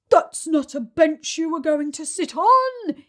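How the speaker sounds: loud booming elephant voice; bellowed. Acted. Trunky bellows at the children, loud booming. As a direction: bellow it across the field. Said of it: "he bellowed".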